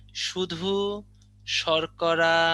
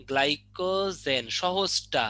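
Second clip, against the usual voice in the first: drawn out very slowly, each syllable stretched; slow emphasis; much slower than usual. Shown as a demonstration. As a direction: drag glycogen সহজটা out slowly so the class hears it. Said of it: "teacher stresses the easy term". (ধীরে টেনে জোর দিয়ে)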